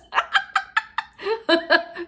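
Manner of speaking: cracking up; high squealing laugh. Real, not acted. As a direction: burst of high, loud laughter. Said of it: "doesn't sound like a princess".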